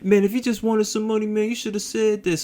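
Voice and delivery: high-pitched mock tearful scolding; crying complaint. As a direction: mimic a crying passenger's wounded grievance. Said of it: "He's crying while he's telling this story".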